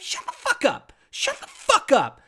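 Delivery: high, strained shut-up; exasperated outburst. Real, not acted. Burned-out worker rants at the work week, high exasperated voice. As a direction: snap it out in disbelief. Angry rant at work schedule.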